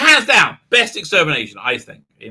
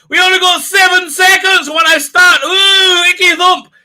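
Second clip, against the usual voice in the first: much higher and louder, shouted; shouted mock Doctor; 12 semitones higher, 7 dB louder than usual. Mocking the Doctor rushing the companions, shouted. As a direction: yell it like a manic Doctor. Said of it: "I said".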